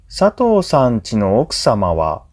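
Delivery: usual speaking voice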